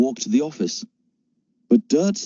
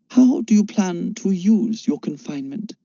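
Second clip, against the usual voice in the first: put-on high detached German-accented question; a hint of a cool German mentor; 9 semitones higher than usual. Klimt questioning Michael in a detached German accent.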